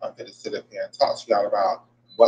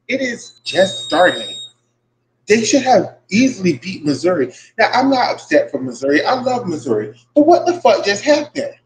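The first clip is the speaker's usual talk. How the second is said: upset fan venting, rising to an outraged shout; real upset outburst; 8 semitones higher than usual. Real, not acted. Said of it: "because I'm so upset right now".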